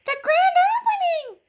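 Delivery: very high, loud shriek with a laugh. A narrator's reading. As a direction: shriek it like an excited teddy bear. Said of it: "shrieked a teddy bear".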